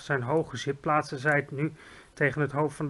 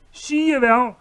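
loud high call; 9 semitones higher, 9 dB louder, slower than usual. A narrator's reading. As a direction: cry it out triumphantly. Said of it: "riep de G V R". (luid roepend)

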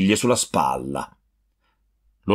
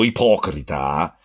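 plain reading voice

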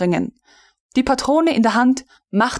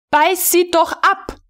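snapping; 10 semitones higher than usual. A narrator's reading. Frau von Melin flaring up at the recruit, losing patience. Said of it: "fuhr der weibliche Exerziermeister auf".

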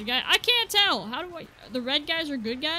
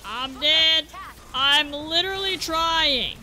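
louder, strained protest; exasperated outcry; 2 semitones higher, 8 dB louder, slower than usual. Real, not acted. Losing to a boss, louder exasperated cry. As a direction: cry out in frustration.

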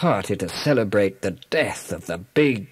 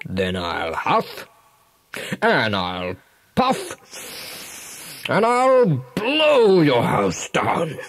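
menacing big bad wolf; 3 semitones higher, slower than usual. Wolf threatening the pig through the door, slow menacing wolf voice. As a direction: drag out every word like a storybook wolf.

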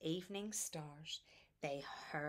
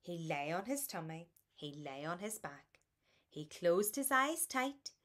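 ordinary delivery, nothing marked

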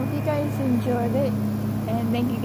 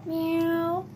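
higher playful meow; imitated cat meow; 3 semitones higher than usual.

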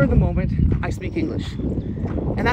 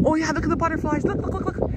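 high-pitched excited outburst, rapid repeats; excited sighting; 10 semitones higher, faster than usual. Real, not acted. Vlogger spotting butterflies for viewers, excited. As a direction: suddenly squeal with delight, urging them to look. Spots butterflies while filming.

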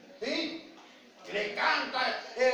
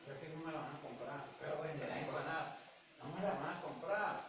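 very soft, low, quick worrying; timid seller; 7 semitones lower, 14 dB quieter than usual. Retold or imitated. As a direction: play a seller too scared to be heard. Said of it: "gente que tiene mucho temor".

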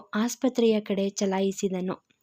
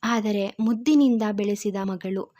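ordinary delivery

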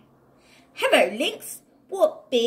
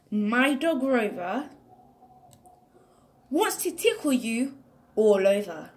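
usual speaking voice

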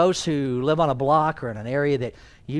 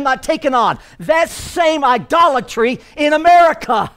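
loud, high, emphatic preacher's delivery; full-force sermon voice, climbing to a shout; impassioned preaching; 9 semitones higher, 7 dB louder than usual. Real, not acted. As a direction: build to a thundering rebuke of the congregation.

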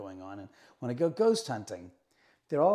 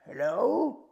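ghostly man's voice; 3 semitones higher, 3 dB louder, slower than usual. Retold or imitated. Man's voice caught on camera in bedroom, ghostly imitation. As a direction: slowly stretch out the eerie question. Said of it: "you can hear this man's voice says".

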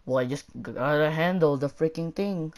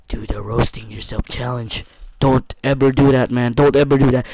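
stern warning; 7 semitones lower, 6 dB louder than usual. Real, not acted. Warning viewers off the challenge.

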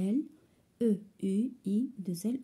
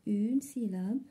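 normal talking voice

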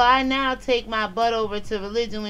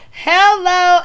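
high, loud sing-song hello; sing-song hello to the troll; loud mock greeting; 8 semitones higher, 10 dB louder than usual. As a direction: belt the hello out in fake cheer.

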